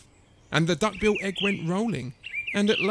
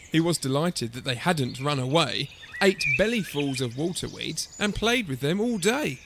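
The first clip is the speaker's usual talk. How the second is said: usual speaking voice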